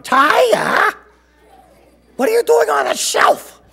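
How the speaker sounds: very high, loud shriek of disbelief; shocked shout. A narrator's reading. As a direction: yell like a dad aghast at his kid. Father finds child on shelf.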